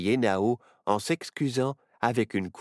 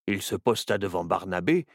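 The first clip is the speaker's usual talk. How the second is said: usual speaking voice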